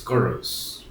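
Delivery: ordinary delivery, nothing marked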